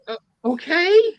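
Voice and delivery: high, slow, bewildered uh okay; puzzled dentist. Retold or imitated. Dentist answering the request, high and baffled. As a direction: act the baffled dentist agreeing. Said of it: "the dentist is like".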